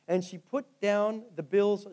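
usual speaking voice

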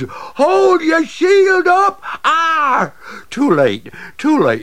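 very high, loud cry to the king; frantic warning. Acted. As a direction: shout out to save him.

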